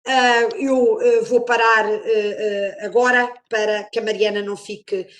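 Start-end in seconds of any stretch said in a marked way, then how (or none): none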